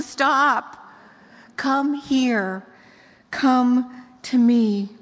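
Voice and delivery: firm then slow and tender; loving father's call. Acted. Heavenly Father calling his children close, firm then tender. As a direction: call them home gently. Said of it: "The Heavenly Father, he's saying".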